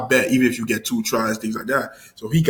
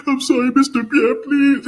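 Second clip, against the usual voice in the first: high, whiny imitation of a sobbing boy; mock crying; 13 semitones higher, 4 dB louder than usual. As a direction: plead in an exaggerated crying voice. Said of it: "Emil was crying".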